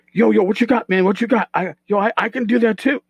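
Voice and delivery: hyped-up talker. Acted. Game master voices a hyped-up bar regular, hyped up. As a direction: play someone always hyped up. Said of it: "somebody that's hyped up and always hyped up".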